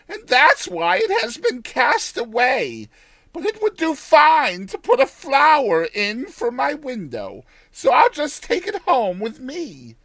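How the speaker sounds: put-on high, loud old village woman; old countrywoman. Old woman deciding to keep it.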